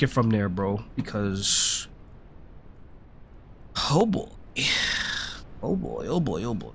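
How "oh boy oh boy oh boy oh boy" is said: frustrated groaning. Real, not acted. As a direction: groan it out under your breath, defeated. Fails the same jump again.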